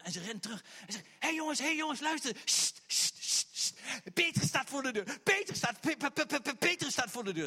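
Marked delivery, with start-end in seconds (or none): 1.2-2.4 high, loud breathless alarm; urgent excited call. Retold or imitated. Rhoda running back to tell the praying group, excited and loud. 2.4-3.7 sharp repeated shushing; hushing shh. Retold or imitated. Rhoda hushing the praying group, sharp shushes. 4.1-7.4 high, loud, stuttering over Pe-; tripping over the name in excitement; excited stammer. Retold or imitated. Rhoda blurting that Peter is at the door, stammering and excited.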